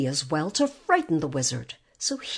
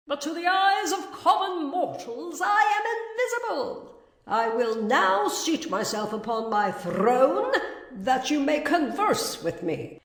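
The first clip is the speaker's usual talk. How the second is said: mighty disembodied Oz; 8 semitones higher than usual. A narrator's reading.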